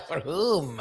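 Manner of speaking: laughing tease. Retold or imitated. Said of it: "I said whom".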